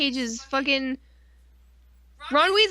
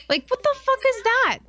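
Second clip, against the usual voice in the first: high, incredulous rant; indignant outburst; 11 semitones higher than usual. Real, not acted. Ranting to a friend about a killed hero, high outrage. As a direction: sound outraged at the writers.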